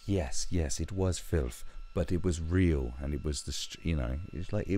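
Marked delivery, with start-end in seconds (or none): none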